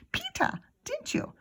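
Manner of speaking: put-on high child voice. Child voice to Peter.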